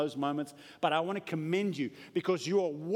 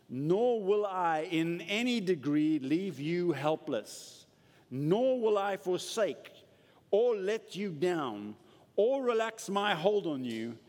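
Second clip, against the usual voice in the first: slow, raised, hammering every "nor"; emphatic scripture reading; 4 semitones higher, slower than usual. Acted. Pastor reading the Amplified verse to the church, slow and emphatic.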